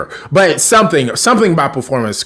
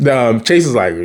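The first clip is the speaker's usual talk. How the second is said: normal talking voice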